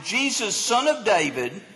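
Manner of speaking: ordinary talk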